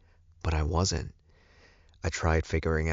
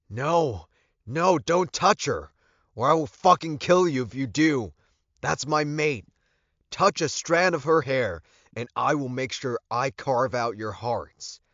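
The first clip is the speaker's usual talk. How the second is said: high, raised threatening shout; raised voice, pitched high and fierce; furious yelling; 9 semitones higher, 3 dB louder than usual. A narrator's reading. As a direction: yell a threat to protect a mate. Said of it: "I yelled at the top of my voice".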